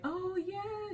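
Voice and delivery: high, drawn-out mock cheer; excited. Friend hears about the message from Della, excited. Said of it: "they're excited".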